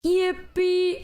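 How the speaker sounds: high, bright little yippee; excited cheer. Real, not acted. Streamer cheering her partner on, a happy high squeal.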